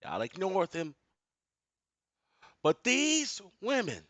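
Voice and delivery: preaching rant. Real, not acted.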